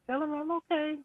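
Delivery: high, firm repeat of Lynn's words; imitating Lynn again. Friend recalling Lynn repeating her wish, high. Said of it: "and she's like".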